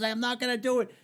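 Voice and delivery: high-pitched mock exasperation; fed-up refusal. Called man refuses.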